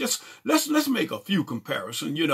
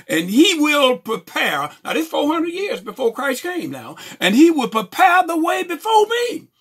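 high, loud proclamation of scripture; preached scripture reading; 11 semitones higher, 6 dB louder than usual. Acted. Preacher reading Malachi to the congregation, loud proclaiming. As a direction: proclaim the verse, building to a cry.

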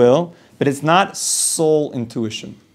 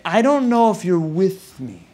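higher, slow and plaintive; hurt doubt; 5 semitones higher than usual. Acted. Client to robot therapist at day's end, mildly hurt. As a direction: voice a lingering doubt, drawn out. Wife's feeling voiced about robot.

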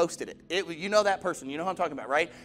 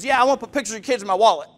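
quick, louder mock insistence; smart-aleck; 2 semitones higher, 8 dB louder, faster than usual. Man needling a mother about her posts, smart-aleck. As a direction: imitate a smart aleck pushing his point. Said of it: "these really smart aleck things".

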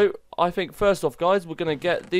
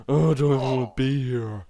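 put-on grumpy teenager. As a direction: grumble it like a reluctant teenager. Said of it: "These are like a grumpy teenagers".

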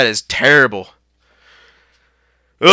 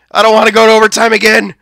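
ordinary delivery

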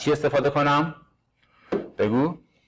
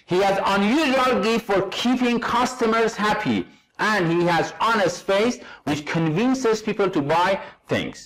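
unhurried classroom reading of an English sentence, each word clear; slow example reading; 9 semitones higher, slower than usual. Shown as a demonstration. Teacher reading the exercise sentence to students, slow and clear. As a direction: read it out slowly so students can follow.

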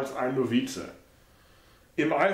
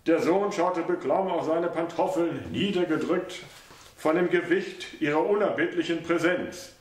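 ordinary delivery

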